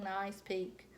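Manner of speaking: plain reading voice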